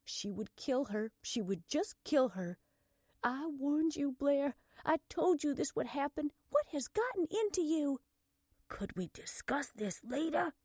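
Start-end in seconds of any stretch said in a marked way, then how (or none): 3.2-8.0 high-pitched reproach; exasperated scolding. Acted. Morgan berating her sister over the arrest, exasperated. 8.7-10.5 higher, strained half-whisper. A narrator's reading. Blair in custody asking her sister, strained. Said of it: "her voice strained".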